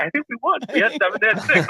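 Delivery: high-pitched retold amazement; retold dawning realization, high; tentative disbelief. Retelling the stunned moment of winning.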